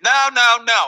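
shouted, high-pitched no no no; mock yell. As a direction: yell it back as the irate customer. Customer yelling no at owner.

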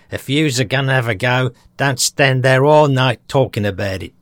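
put-on Aussie accent, an impatient interruption; Australian accent. Host re-enacting an Aussie trooper's story, impatient Australian accent. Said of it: "take the mickey out of my Australian accent".

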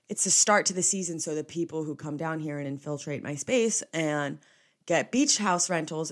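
normal talking voice

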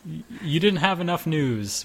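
ordinary delivery

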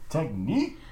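hype man call. Pretend. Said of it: "never yelled transphobia in a hype man voice".

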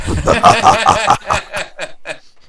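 loud, high airy laughter; big unvoiced laugh; breathless unvoiced laugh. Real, not acted. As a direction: wheeze with laughter at the joke. Laughs at own joke.

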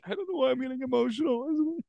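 hammed-up weepy contestant; teary Noelle imitation. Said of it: "and she's like".

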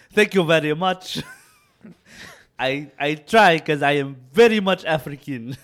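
loud, proud mock African accent; exaggerated African-accented thanks. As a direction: accept praise in a hammed-up African accent. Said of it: "That's a good accent".

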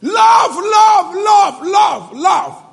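high, loud, hammered repetition; shouted preaching. Real, not acted. Preacher shouts love at his church, loud and high. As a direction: drive the word home to the crowd.

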